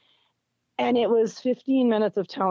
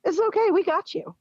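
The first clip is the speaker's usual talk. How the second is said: higher, gently comforting; reassuring nurses; 10 semitones higher than usual. Retold or imitated. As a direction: soothe a frightened patient. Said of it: "just being like".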